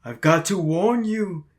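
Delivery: slow, dragged-out doom warning; slow ominous raspy drawl. Acted. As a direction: warn them like a crazy old prophet.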